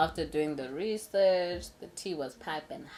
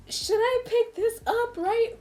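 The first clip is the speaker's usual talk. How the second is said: high, much louder mock dithering; squeaky hesitation; 9 dB louder than usual. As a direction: pitch it up, torn and disgusted. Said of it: "So I'm like".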